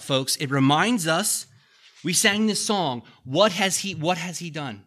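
loud, rising sermon push; preaching at full force. Acted. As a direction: drive the verse home to the congregation.

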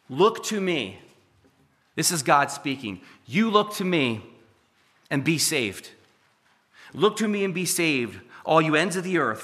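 fuller, rising sermon voice; preaching God's call. Acted. Preacher voicing God calling everyone, a building sermon. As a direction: proclaim it to the whole room.

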